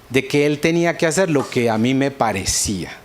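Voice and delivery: ordinary talk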